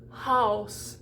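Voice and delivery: Haus with an open ow; German Haus. Shown as a demonstration. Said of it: "it would change the ow".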